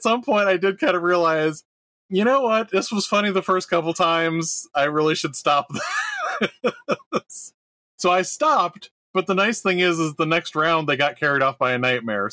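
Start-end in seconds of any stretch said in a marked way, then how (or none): 5.7-7.5 laughing. Real, not acted. Laughing while confessing a bad habit.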